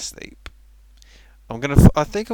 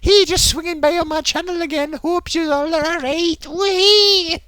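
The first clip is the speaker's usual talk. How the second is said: high, hammed-up breezy greeting; chirpy video host; 12 semitones higher, 5 dB louder than usual. Imitating streamer welcoming channel viewers, over-jolly. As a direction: pitch it way up and finish on a squeal. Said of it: "It's the exact opposite of what he does".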